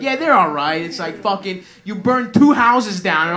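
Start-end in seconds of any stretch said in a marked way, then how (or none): 0.0-0.8 louder, dismissive mock of a mumbled answer; mocking his shrug. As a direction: toss it off like a sulky son. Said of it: "You're like".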